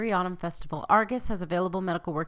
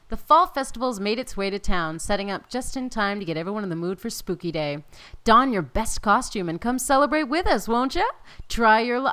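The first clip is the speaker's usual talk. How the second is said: louder, bouncy ad-style read; announcer read; 3 semitones higher, 5 dB louder than usual. Acted. Gamer reading the festival card aloud, hammed-up announcer. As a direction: pitch the festival like a town crier. Reads festival event text.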